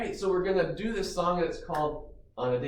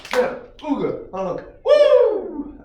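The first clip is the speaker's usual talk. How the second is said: goofy honks ending in high loud woos; silly clown; 12 semitones higher, 10 dB louder than usual. Shown as a demonstration. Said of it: "fun song motions and sounds".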